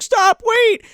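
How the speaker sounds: high, loud imitated plea; mock panicked call. Boy chasing her through snow, high mock panic. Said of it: "And he's like".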